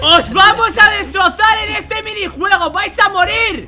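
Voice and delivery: loud slow taunting yell; raging gamer. Real, not acted. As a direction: roar threats at the rivals. Taunting rivals in minigame.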